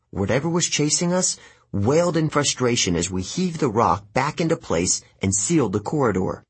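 ordinary delivery, nothing marked

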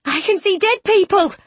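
high-pitched, excited announcement; amazed revelation. A narrator's reading. Bronte revealing her new gift, amazed. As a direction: exclaim in wonder, voice climbing.

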